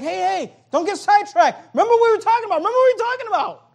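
high, loud insistent calling back; Jesus correcting. Retold or imitated. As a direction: pull distracted followers back on track. Jesus voiced correcting disciples.

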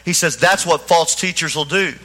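ordinary talk

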